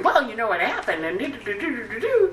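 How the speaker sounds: high, sing-song gossip prattle; chatty gossip. Acted. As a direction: babble it like a busy gossip. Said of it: "He's busy gossiping and sharing information".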